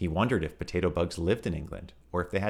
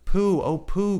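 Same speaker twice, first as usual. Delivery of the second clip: much higher, louder little piglet voice; piglet crying out; 9 dB louder, much slower than usual. Acted. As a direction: squeak out his name in relief. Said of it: "cried the piglet".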